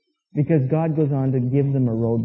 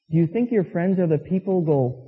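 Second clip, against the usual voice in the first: ordinary talk, nothing marked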